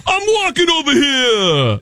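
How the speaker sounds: angry, high New York shout; New York accent. Shown as a demonstration. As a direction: yell it like an irate New Yorker. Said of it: "least sexy is New York".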